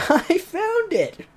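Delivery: high, slow, laughing through the shout; laughing excited shout. Acted. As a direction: crack up mid-cry. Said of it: "popped out at the very moment shouting".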